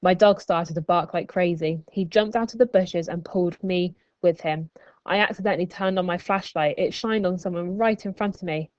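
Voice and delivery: plain reading voice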